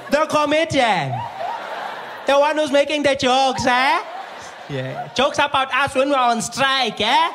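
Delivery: put-on high, loud, taunting accented voice; accusing nurse. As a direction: needle him about the jokes.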